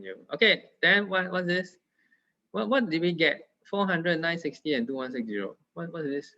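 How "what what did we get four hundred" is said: ordinary talk, nothing marked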